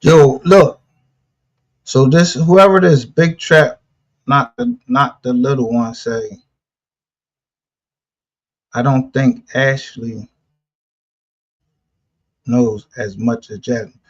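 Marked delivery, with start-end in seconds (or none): none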